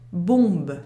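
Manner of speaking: "bombe" with OM as one nasal vowel, then B; slow "bombe", no M sound before the B. Shown as a demonstration. Teacher showing how the word is pronounced, nasal vowel in "bombe". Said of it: "Le mot se prononce".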